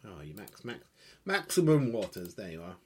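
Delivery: ordinary talk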